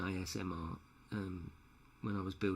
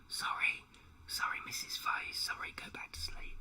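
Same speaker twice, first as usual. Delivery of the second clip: unvoiced whisper to not wake her; whispered apology; 5 dB quieter than usual. Real, not acted. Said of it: "That just woke my girlfriend up".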